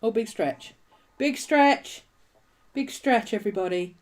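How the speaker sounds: high, sing-song pet praise. Real, not acted. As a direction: coo it up high at the stretching dog. Cooing to her dog stretching.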